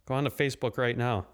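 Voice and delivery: normal talking voice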